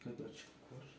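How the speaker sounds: breathy whisper. Real, not acted. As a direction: sneak a tense whisper. Sneaking through haunted house.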